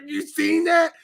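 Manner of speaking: excited disbelief. Retold or imitated. Viewer reacting to a crazy scene, excited near shout. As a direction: blurt it out, shaken and thrilled. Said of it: "And I'm like".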